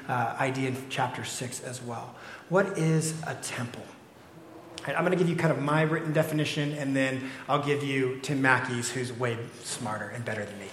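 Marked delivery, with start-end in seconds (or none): none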